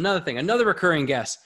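quick, hurried delivery; pressured rushing speech. Real, not acted. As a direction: rush through it to wrap up. Talking a mile a minute.

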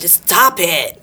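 normal talking voice

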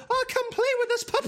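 very high mock French child voice; French medieval children. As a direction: squeal it like a storybook French kid. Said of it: "French children from a medieval setting".

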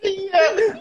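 high, drawn-out mock yeah; imitated strained chatter. Friend demonstrates the talking in the scene, high imitation. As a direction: imitate movie characters in a high squeal. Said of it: "there's a whole lot of this".

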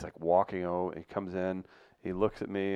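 ordinary delivery

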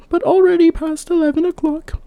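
put-on a young maiden, high and light, asking; high girlish maiden voice. Maiden asking the old woman, high girlish voice. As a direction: lift into a bright girl's voice.

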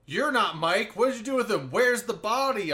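high, loud mock accusation; exaggerated interrogation; read-aloud comment. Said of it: "all of a sudden it's like".

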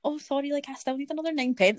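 high, faux-apologetic nudge; sweetly winding up. Server teasing the rude driver, high sweet mock apology. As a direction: needle her with politeness. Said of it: "kinda winding her up".

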